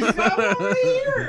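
high slow laughing words. Real, not acted. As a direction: giggle the words out. Laughing at the empty cup.

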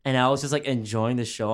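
ordinary delivery

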